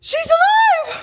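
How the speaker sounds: gasp, then very high, drawn-out scream; shocked scream. Real, not acted. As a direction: shriek it in overjoyed disbelief. Discovers the character is alive.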